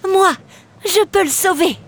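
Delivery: high little mermaid voice; small voice. Acted. Mermaid offering to save the captain, small high voice. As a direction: offer help in a small, eager voice. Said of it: "une petite voix s'éleva".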